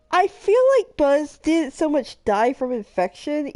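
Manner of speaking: usual speaking voice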